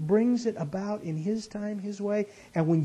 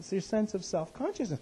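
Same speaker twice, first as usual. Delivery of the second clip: usual speaking voice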